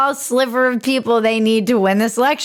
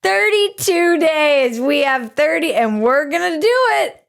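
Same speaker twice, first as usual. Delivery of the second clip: hammed-up scared politician, pitched way up; mock scared; 8 semitones higher than usual. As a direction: mimic a stranded speaker stalling in fright. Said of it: "she just gets scared and her eyes get big".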